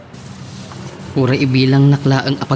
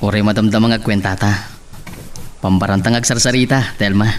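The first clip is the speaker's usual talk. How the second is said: ordinary talk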